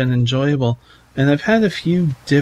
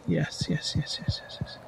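whispered yes; 14 dB quieter than usual. Real, not acted. Excited at the community board.

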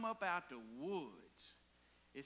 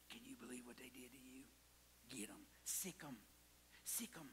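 fast, hushed whisper of a tempter; sly devil whispering in the ear; devil's whisper; 8 semitones lower, 12 dB quieter than usual. Acted. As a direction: hiss it into their ear like the devil.

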